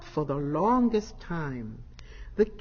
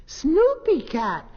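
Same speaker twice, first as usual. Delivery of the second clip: high, loud and slow greeting; joyful cry; 8 semitones higher, 6 dB louder, slower than usual. A narrator's reading. Kittens welcoming their sister home, joyful. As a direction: call her name with delight. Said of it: "they cried joyfully".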